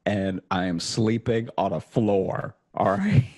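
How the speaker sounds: half-hearted desperate begging, laughing at himself; mock pleading. Begging the bank agent.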